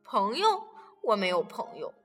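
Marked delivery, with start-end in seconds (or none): 1.0-1.9 sad quiet admission. Acted. Lonely plesiosaur.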